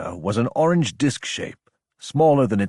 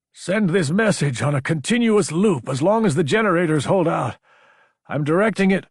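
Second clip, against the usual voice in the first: raised, urgent message voice; transmission voice; 5 semitones higher than usual. A narrator's reading. Sender instructing on a looped distress message, urgent and raised. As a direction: broadcast it across the stars, pitched up. Recorded transmission read in character.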